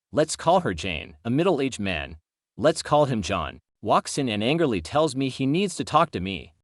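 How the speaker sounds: ordinary delivery, nothing marked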